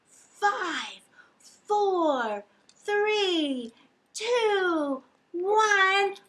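from plain to very high, loud shout; building countdown to blast off. Acted. Counting down with the kids, rising to a shouted blast off.

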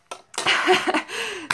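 high burst of laughter; laughing. Real, not acted. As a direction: laugh out loud. Laughing through the retractor.

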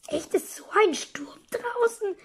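hushed whispered question. Acted. Asking flatmate about the storm, whispered. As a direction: whisper it in awe.

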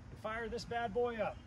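ordinary talk, nothing marked

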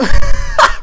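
shrill burst of laughter at the scare; high startled laugh. Real, not acted. Gamer laughing at the jump scare, high burst of laughter.

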